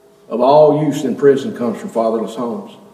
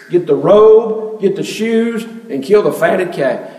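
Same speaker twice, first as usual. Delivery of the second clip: a higher, louder, slow call from the overjoyed father; joyful father calling out; 6 semitones higher, 5 dB louder, slower than usual. Retold or imitated. Father ordering servants to celebrate son's return, joyful higher louder slow call. Father welcomes prodigal son home.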